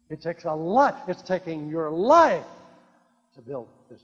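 ordinary talk, nothing marked

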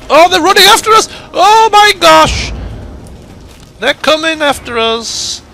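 high, loud panicked squeal; frantic high-pitched cry. Real, not acted. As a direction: shriek in mock alarm. Raiders chasing.

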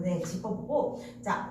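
ordinary delivery

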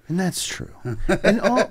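normal talking voice

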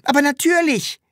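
high, loud, emphatic reply; high-pitched exclamation; calling back. A narrator's reading. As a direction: call it back as if obvious. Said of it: "rief das Sams zurück".

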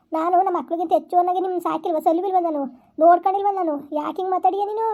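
ordinary delivery

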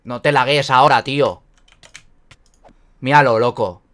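loud frustrated shouting; raging gamer. Real, not acted. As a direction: yell at the laggy game. Game lagging mid fight.

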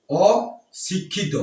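অশিক্ষিত drawn out very slowly, sound by sound; slow demo word. Shown as a demonstration. Teacher spelling out a word for students, very slow. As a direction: stretch out অশিক্ষিত syllable by syllable. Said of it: "যদি বলা হয়".

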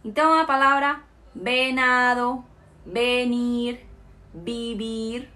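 venado, venir, vivir all with a B sound. Shown as a demonstration. Teacher showing students the V read as B. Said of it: "Lembrando que tem som de B".

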